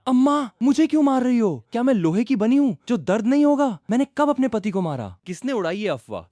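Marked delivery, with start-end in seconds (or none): 0.1-5.2 high, loud whiny protest from the daughter; बेटी की ऊँची, रूठी हुई शिकायत; sulky daughter protesting. Acted. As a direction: cry out against the beating, pouting. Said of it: "मुयान मुंह बनाकर बोली".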